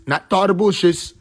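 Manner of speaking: ordinary delivery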